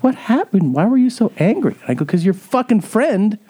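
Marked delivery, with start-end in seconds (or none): 0.0-1.8 much higher, small timid voice; imitated meekness. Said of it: "meek, and she was like". 2.0-3.4 high, slow, emphatic retort; retold exasperation. Retold angry answer to the mother.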